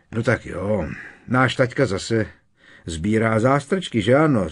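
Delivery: jovial. A narrator's reading. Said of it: "řekl Fred rozšafně".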